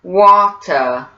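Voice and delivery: slow British Water, "waw-tuh"; British-accent teaching demo of Water. Shown as a demonstration. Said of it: "let's try it in a British accent".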